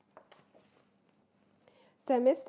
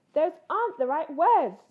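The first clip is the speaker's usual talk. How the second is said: louder, higher indignant little cub; higher, much louder bossy cub; 5 semitones higher, 9 dB louder than usual. Acted. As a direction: complain like a know-all cub. Cub corrects loudly.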